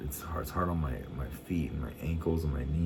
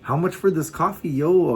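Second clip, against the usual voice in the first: higher and louder, indignant; mock exasperated; 11 semitones higher, 8 dB louder than usual. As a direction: demand the price like you've been ripped off. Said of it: "I was like".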